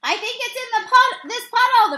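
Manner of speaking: high, loud eager shout; first baseman shouting to teammates. A narrator's reading. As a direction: call out pointing at the puddle. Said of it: "the first baseman shouted".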